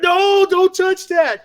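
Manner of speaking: sharp high alarmed warning, slowed; urgent don't touch. Retold or imitated. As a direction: stop the kid grabbing the tape, urgently. Dad stopping son grabbing tapes.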